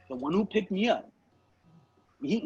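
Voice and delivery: ordinary talk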